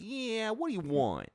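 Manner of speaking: loud, high Brooklyn goblin with a long-drawn "Yeah"; hammed-up Brooklyn yell; Brooklyn accent. Gamer imitating goblins from Brooklyn, loud hammed-up Brooklyn accent. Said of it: "They're all from, like, Brooklyn".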